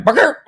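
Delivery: high imitated double bark; two quick dog barks, up high; dog barks imitation. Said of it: "your sound effects are spot on".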